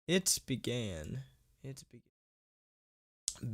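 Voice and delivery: slow, fading spooky read; mock spooky fade; spooky slowdown. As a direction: drag the words out and let them die away. Slowing and fading the line.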